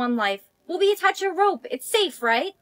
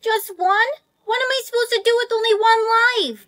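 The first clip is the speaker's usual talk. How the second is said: loud, higher-pitched protest; dismayed disbelief; 5 semitones higher, 7 dB louder than usual. Acted. Student learns she got only one life, dismayed protest.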